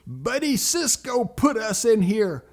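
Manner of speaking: put-on high, loud child's tattling; blurted accusation. Rescued boy tells daddy who did it, blurting. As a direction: blurt out the blame at full voice.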